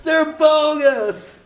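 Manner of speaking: high, drawn-out shrieking yell; screaming. Retold or imitated. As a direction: scream it out at the crowd. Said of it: "out in the street screaming".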